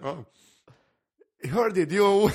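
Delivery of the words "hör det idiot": put-on loud laughing Gotlandic accent, high up. Egged on to continue, he laughs through a Gotlandic accent. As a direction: push through the giggles in a bad Gotlandic accent. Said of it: "Fortsätt, fortsätt".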